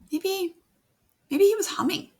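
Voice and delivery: high, loud eager suggestion; excited idea. Real, not acted. Teacher eagerly suggests humming to the class, excited.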